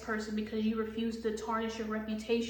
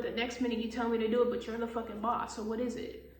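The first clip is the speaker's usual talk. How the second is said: ordinary delivery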